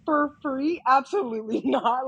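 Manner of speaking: incredulous. Retold or imitated. Retold refusal to photographers.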